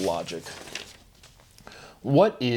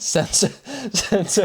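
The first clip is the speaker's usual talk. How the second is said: trying to speak through giggles, higher voice; breaking into laughter; 8 semitones higher than usual. Real, not acted. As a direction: start the word and lose it to laughter. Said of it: "that one made me laugh".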